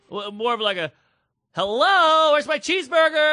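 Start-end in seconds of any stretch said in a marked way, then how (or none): 1.5-3.3 high, loud sing-song complaint; camp angry customer. Retold or imitated. Friend correcting the impression of the yelling customer, high loud diva demand. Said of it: "it was that kind of thing".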